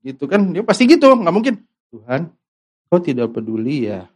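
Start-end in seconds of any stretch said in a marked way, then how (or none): 1.9-4.1 calm sad question. Shown as a demonstration. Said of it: "Nggak kan gitu ya".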